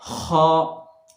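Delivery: खा scraped out from the upper throat; scraped kha. Shown as a demonstration. Teacher demonstrating the letter, scraped from throat. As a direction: clear the throat into खा. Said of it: "खोलने के बाद बोलें".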